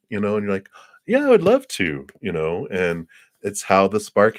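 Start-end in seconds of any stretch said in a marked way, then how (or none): 1.1-2.1 mock delight, much higher and louder. Woman accepting a stranger's coffee invite, delighted. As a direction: gush the yes like a swooning date.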